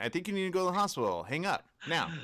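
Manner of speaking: high pretend voice brushing off a scammer; mock fussy answerer. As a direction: fuss at the caller. Pretend answerer to scam call.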